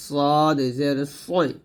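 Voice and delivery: "صاد জের সই" with a thick, emphatic sad; heavy sad. Shown as a demonstration. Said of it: "মোটা করে".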